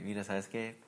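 quiet, resigned voicing of the Lord ending it; hushed, weary breakup voice; the Lord breaking up. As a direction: let the words drop low and final, like a last goodbye. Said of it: "el Señor me diga así".

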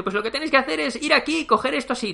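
high, fast mock orders; bossy emperor. ([name] dando órdenes, agudo y rápido)

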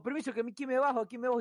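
put-on old lady getting off. Teller mimics the old lady getting off, pitched high. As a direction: squeak out the old lady's exit line. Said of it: "Y ahí dijo".

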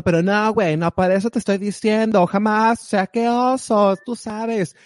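high, mock posh Mexico City fresa; mock fresa slang. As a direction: play a snobby rich kid, pitched high. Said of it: "se hace un boom con los fresas".

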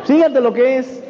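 rising excited call to listen; excited outburst. Real, not acted. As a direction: shout it out like the big reveal. Excited turning point of story.

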